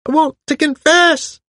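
very high, loud, frantic caller; high-pitched hysterical voice. A narrator's reading. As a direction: shriek it in a strange, strained high pitch. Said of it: "A strange, high-pitched, hysterical voice came".

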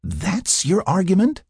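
drawn-out, high-pitched disbelief; incredulous. A narrator's reading. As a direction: ask it in open disbelief. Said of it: "She couldn't believe what she was hearing".

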